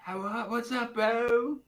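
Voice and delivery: high, lazy beach-bum voice; high-pitched laid-back bro. Acted. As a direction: greet them like a tipsy beach bum. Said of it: "like, a beach bum".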